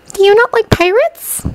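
high, questioning schoolgirl; curious. A narrator's reading. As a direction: probe gently, curious. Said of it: "the girl continued questioningly".

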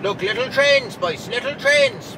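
high, loud, gleeful pointing out. Real, not acted. Driver shows viewers the little trains, high childlike glee.

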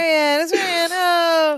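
very high echoed squeal; mock delighted greeting. Host echoes Larry greeting Marianne, mock delight. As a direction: gush in a put-on high voice.

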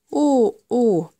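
mildly alarmed storybook octopus; a touch of fright, narrated gently; erschrocken octopus: startled. A narrator's reading. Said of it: "erschrak der Oktopus".

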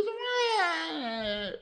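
high-pitched cartoon distress; shocked cry. Dirty Birdie shocked on the table, high wailing protest. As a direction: climb high into a drawn-out wail on "why". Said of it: "Dirty Birdie is shocked".

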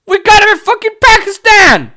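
bursting with outrage at the absurdity; outraged shout. Real, not acted. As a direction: shout it, pitch jumping way up. Ranting about bin Laden.

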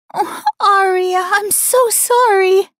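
high, slow tearful apology; whimpering. A narrator's reading. Mistress apologizing to the Luna, whimpering. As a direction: plead through tears. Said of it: "she whimpered".

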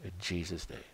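soft, hushed prayer voice; preacher closes the sermon with a soft, hushed prayer; closing prayer. Acted. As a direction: pray it quietly, fading to amen.

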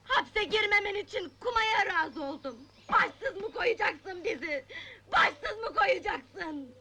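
sobbing, anguished plea; tearful pleading. Acted. Wife begging her man not to leave them, sobbing. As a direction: plead through tears, dragging the last words out.

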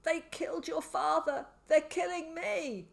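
high-pitched madman's wail, slowing at the end; desperate cry. A narrator's reading. Crazed man tells boy of father's killing, desperate.

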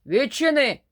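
loud demanding shout from outside the door; a tiny old man yelling to be let in. Acted. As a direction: bellow it through the door like a bossy little gnome. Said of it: "кричить".

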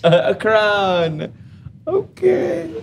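slow, high teasing drawl; mocking drawl. Real, not acted. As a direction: stretch it out, teasing.

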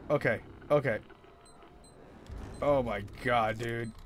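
ordinary delivery

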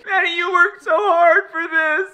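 high, slow, crying voice; tearful pride. Real, not acted. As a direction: choke up with pride as you say it.

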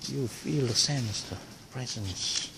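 hushed voice, barely voiced; whispering. Real, not acted. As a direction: speak hushed, like in church. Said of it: "I have to whisper because".

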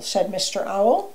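ordinary delivery, nothing marked

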